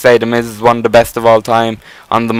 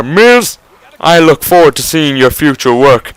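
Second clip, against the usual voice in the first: sarcastic. Retold or imitated. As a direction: put on a sarcastic voice, as if making fun of him. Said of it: "say sarcastically to him".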